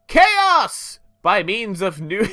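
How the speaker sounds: enthusiastic narration. Acted. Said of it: "That was a lot of enthusiasm".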